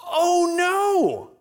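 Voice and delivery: high, pinched dismayed voice; sudden alarm, played for laughs; dismayed cry. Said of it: "He goes".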